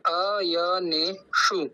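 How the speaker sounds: अयनेषु slow, each syllable whole, retroflex ष; full pronunciation. Shown as a demonstration. Gita teacher models the word, slow and full. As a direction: say अयनेषु slowly and completely. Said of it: "हर शब्द को पूरा कहना है".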